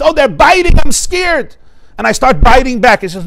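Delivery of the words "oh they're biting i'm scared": high, louder mock alarm; exaggerated scared cry, pitched up. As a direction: play-act being frightened of a bite. Voices a scared reaction to biting.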